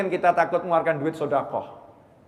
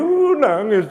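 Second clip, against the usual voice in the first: higher, louder, drawn-out mock wail; mock frightened crying; 5 semitones higher, 7 dB louder, slower than usual. Preacher mimicking someone hearing a ghost, mock frightened wail. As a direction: imitate someone scared into tears. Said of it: "Dengar suara begitu".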